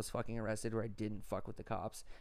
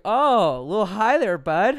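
taunting cop; 7 semitones higher, 14 dB louder, slower than usual. Retold or imitated. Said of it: "started at Class A felony".

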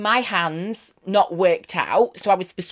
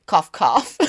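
mock cough; 4 semitones lower than usual. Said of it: "not that you're jealous".

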